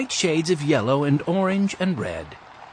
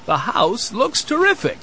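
higher, hearty grandfather's call; lightly raised, approving call; 9 semitones higher than usual. A narrator's reading. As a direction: call it out through a car window. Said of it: "he called out the open window".

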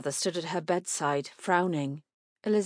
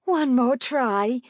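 slow, high, louder plea; pleading; 6 semitones higher, 7 dB louder, much slower than usual. A narrator's reading. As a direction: beg him, drawing out each word. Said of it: "Missus Bennet pleaded".

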